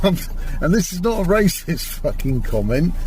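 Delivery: usual speaking voice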